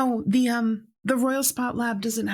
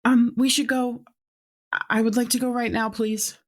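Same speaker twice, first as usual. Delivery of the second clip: nervous, near tears; 5 semitones higher, 3 dB louder than usual. Acted. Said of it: "she looks like she's about to cry".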